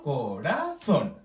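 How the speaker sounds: "corazón" drawn out slowly, syllable by syllable; slow teacher's "corazón", ending on a separate stressed "zón"; stress on final syllable. Shown as a demonstration. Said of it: "Pronunciamos más fuerte el zon".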